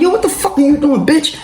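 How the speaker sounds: high, startled disbelief; high incredulous cry; shocked. Retold or imitated. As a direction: caught off guard as she bolts. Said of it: "I'm like".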